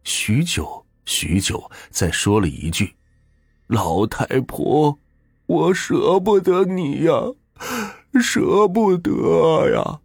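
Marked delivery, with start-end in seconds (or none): 3.6-10.0 very slow, high, crying; old man who cannot let his wife go; slow, breaking sobbed words; weeping farewell. A narrator's reading. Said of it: "也流下了浑浊的眼泪".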